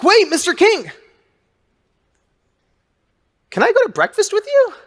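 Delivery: high, pleading excited call; eager plea. Retold or imitated. Fan begging Larry King at his car, high eager plea. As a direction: beg him like a starstruck fan.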